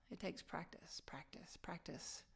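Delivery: very soft, near-whispered repetition; hushed. Real, not acted. Speaker urging listeners to keep practicing, hushed. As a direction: let each "practice" sink almost to a whisper.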